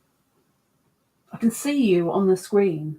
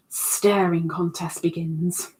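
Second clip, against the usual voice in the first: slow whispered announcement; 4 semitones lower, slower than usual. Real, not acted. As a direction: hush it like a secret. Announcing a staring contest.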